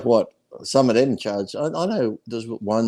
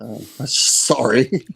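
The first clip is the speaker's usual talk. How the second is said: mock apology; 2 semitones higher, 3 dB louder, much slower than usual. Mock apology to online critics.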